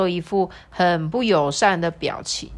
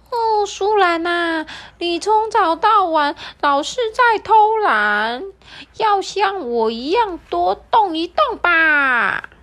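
much higher, slow parrot voice; high chirpy parrot; 11 semitones higher, 5 dB louder, slower than usual. Acted. Parrot answers the sloth.

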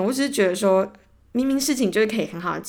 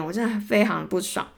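normal talking voice

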